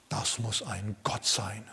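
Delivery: slow, hushed whisper of wonder; awed whisper. Retold or imitated. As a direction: whisper as if a god stood before you. Islanders murmur in awe. (ehrfürchtiges Flüstern)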